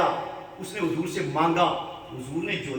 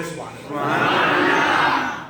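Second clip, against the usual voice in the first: louder, drawn-out cry of praise; 4 semitones lower, 6 dB louder, much slower than usual. Real, not acted. Congregation calling back loudly at the preacher's request. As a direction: answer with full voice this time. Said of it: "دل ہی نہیں، زور سے کہنا".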